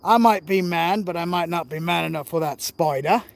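a loose imitation of an Australian bushman; lightly Australian outback guide, playful; mock Crocodile Dundee. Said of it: "He's like".

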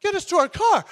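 high, loud fed-up plea; tired passengers begging the driver, loud and high; exasperated shout. Retold or imitated. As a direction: demand it like a worn-out passenger. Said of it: "And we're like".

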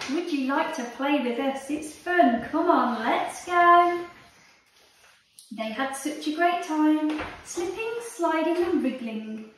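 lively read-aloud storytelling voice; reading to children. Acted. Teacher reading a picture book to her class, lively and animated. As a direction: tell it like a bedtime story for little ones.

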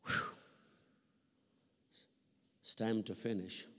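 ordinary talk, nothing marked

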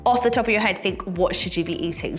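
ordinary talk

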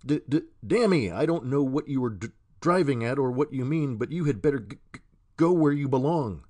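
blurting in anger, tongue tripping on consonants; angry stutter. A narrator's reading. Billy angrily sends the stranger away, stuttering loudly. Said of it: "his vocal infirmity somewhat intruded".

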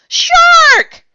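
very high, very loud alarm yell. A narrator's reading. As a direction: scream the warning at full force. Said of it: "somebody yelled".

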